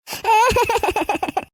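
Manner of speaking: long, stuttering sob; acted crying fit trailing downwards; mother's sobbing. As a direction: weep without words, self-pityingly. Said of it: "அம்மா, அழாதீங்கம்மா".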